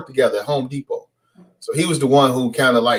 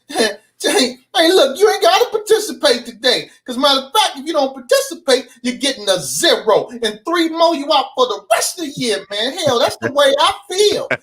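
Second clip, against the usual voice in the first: much higher, put-on stern teacher voice; gym teacher; 10 semitones higher than usual. Gym teacher threatening zeros over his newspaper, mock stern. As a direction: lay down the law like a fed-up coach.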